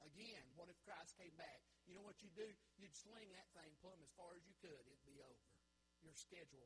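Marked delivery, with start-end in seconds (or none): none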